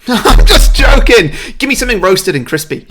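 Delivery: hyper, high-pitched shouted jest; James Corden. Acted. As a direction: explode into the joke, loud and cockney. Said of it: "definitely channeling James Corden's, like, hyper energy".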